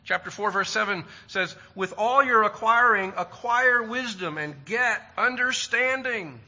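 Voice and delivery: scripture reading. Real, not acted. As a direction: proclaim the verse with weight. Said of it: "verse five says".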